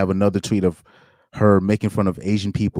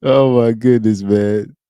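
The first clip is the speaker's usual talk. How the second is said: loud laughing disbelief; 3 semitones higher, 6 dB louder, slower than usual. Real, not acted. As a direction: burst out laughing. Laughing at a shocking tweet.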